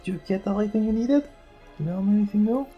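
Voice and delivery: usual speaking voice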